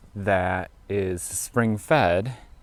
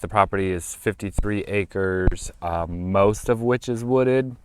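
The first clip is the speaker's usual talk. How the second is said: normal talking voice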